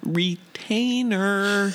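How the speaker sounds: mock dramatic. Dramatic quote line, very stretched.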